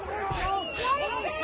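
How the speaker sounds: a sudden high squeal of laughter; high giggle. Real, not acted. Watching police fail, a high giggle.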